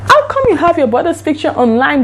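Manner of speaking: hammed-up shocked disbelief at the lie; retold disbelief. Catches him lying about photo.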